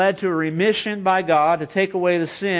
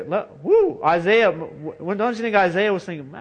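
normal talking voice

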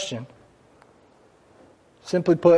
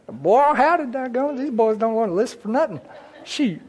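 high-pitched, hammed-up country twang; 9 semitones higher than usual. Preacher mocking his own accent slip, exaggerated country twang. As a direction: put on a comic high country voice. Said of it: "country twang got in there real fast".

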